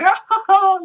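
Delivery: high, loud cackling laugh; imitated maniacal laughing; villain laugh. Killer laughing off his victims' painful death, loud cackle. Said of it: "I'm, like, laughing, going".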